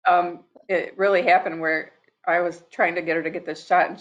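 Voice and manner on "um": ordinary delivery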